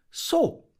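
high sharp call; dove calling. Acted. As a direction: announce the end of the meeting. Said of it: "rief Frau Taube".

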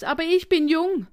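put-on high, loud childish retort; indignant. A narrator's reading. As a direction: insist in outrage. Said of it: "empörte sich Fips".

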